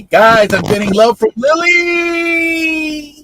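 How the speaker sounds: high, loud, slow stretched-out shout; excited announcement. Real, not acted. Streamer gleefully telling the chat that a viewer sent love, in a stretched-out shout. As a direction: sing it out to the whole room.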